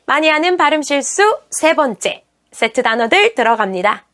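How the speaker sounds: ordinary talk, nothing marked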